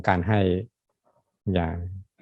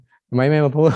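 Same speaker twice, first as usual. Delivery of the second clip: higher, louder, half-laughing mock scold; imitating a scolding; 8 semitones higher, 5 dB louder than usual. Monk imitating his partner's scolding, a higher, louder mock complaint. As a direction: play the partner snapping back in jest. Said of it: "แต่โดนเขาว่าอีกนะ".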